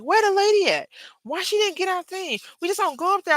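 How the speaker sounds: very high-pitched mimicry of a frantic man; freaking out. Roommate fretting about the school pickup, mock freak-out. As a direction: imitate his panic, starting loud and staying high. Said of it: "he started freaking out".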